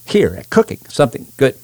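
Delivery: ordinary talk, nothing marked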